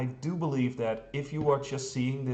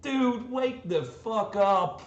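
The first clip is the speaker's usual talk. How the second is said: exasperated mock rebuke; 10 semitones higher, slower than usual. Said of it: "and it's like".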